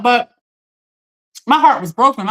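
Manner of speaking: ordinary talk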